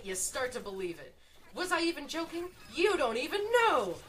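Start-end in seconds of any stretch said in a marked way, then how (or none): 1.5-4.0 high, loud, mock-defiant; tease, climbing into a shout; gleeful taunt. Streamer blurring joke and boast, gleeful loud taunt.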